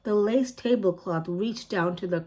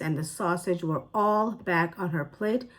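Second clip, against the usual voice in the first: ordinary delivery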